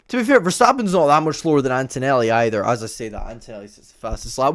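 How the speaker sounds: ordinary talk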